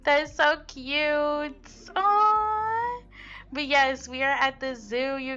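slow, high cooing aww. Real, not acted. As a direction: coo over something adorable. Cooing at baby and dog.

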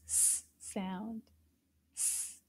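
soft hissed s; quiet voiceless S; s sound. Shown as a demonstration. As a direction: hiss the bare s quietly. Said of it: "begins with the s".

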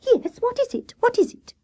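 very high, excited questioning; high-pitched chorus of curious little animals; eager asking. A narrator's reading. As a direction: pipe up eagerly, wanting to know. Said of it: "they all asked".

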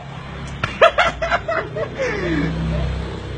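long high-pitched laughing fit; real uncontrollable giggling; helpless laughter. Real, not acted. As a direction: crack up and keep laughing, high then falling. Helpless laughter at password mix-up.

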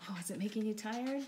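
ordinary delivery

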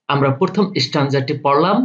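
normal talking voice

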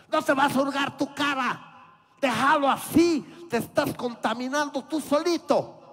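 loud, high nagging scold; family scolding. Retold or imitated. Family telling the boy to stop picking his face, loud and high. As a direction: nag him loudly like a parent. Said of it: "te dicen, tu familia".

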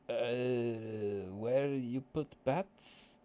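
put-on slow question in a Russian accent. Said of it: "We speak bit of Russian accent".

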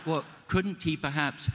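high, wheedling little plea; tentative pleading. Retold or imitated. Actor pleads with George for his character, tentative. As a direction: pitch it up, politely angling for a reprieve.

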